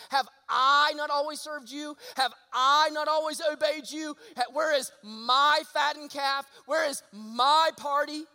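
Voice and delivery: put-on older brother confronting his father, indignant and loud; resentful complaint. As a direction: hammer each question like a wronged older son. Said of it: "he says".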